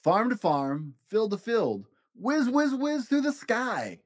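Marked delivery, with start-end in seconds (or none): none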